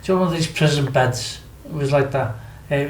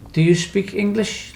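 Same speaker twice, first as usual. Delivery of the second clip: slow, higher-pitched question to a foreigner; retold question, slowly enunciated; slow, careful question; 6 semitones higher, slower than usual. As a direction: spell it out slowly for a non-native listener. Questioning armed guard if he speaks English.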